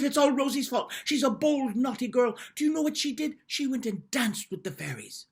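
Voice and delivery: high, loud whiny telling-on; tattling. Acted. Big sister telling on little sister to parents, whiny. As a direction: snitch on your sister, indignant.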